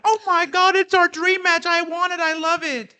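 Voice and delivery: high mock-gushing online fan. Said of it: "everybody online was like".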